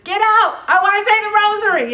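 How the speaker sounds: high, loud and fast, hammed-up; mock shout. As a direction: jokingly shoo them away. Mock shooing away a talker.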